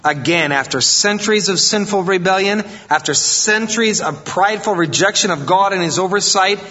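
fiery preacher driving the point home; preaching at full force. Acted. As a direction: preach it out, rising to a peak. Pastor building to preaching peak.

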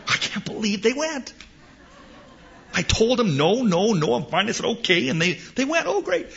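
put-on a put-upon parent who can't believe the kids left; mock exasperated parent. Parent complaining about the kids, in a high exasperated whine.

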